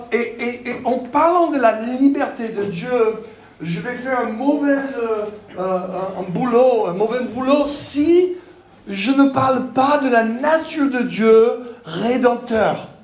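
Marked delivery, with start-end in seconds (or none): none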